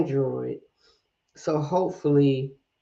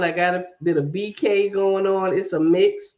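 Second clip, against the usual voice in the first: usual speaking voice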